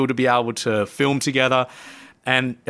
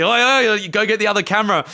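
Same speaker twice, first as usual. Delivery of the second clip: calling out; 9 semitones higher, 3 dB louder, faster than usual. Retold or imitated. Calling out to the guide.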